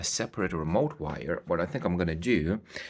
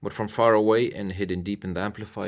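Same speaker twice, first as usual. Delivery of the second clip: ordinary talk, nothing marked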